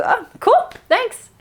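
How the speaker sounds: very high-pitched, airy mock thanks; light humorous reply. Reply to a silly horoscope, light and humorous. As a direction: take it as harmless fun. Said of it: "it's a light, humorous moment".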